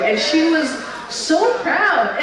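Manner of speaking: normal talking voice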